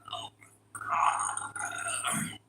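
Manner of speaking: slow breathy whispered oh my God; exasperated whisper. Retold or imitated. Fed up with disrespectful pups, slow whisper. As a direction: let it out in a long weary whisper. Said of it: "Sometimes I just be like".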